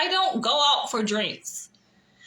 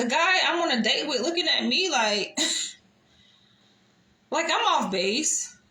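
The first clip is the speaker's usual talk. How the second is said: ordinary talk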